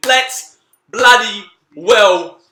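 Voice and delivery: loud, drawn-out cheer for Geelong; excited cheer. Real, not acted. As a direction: belt it out like a fan celebrating. Cheering Geelong finals news.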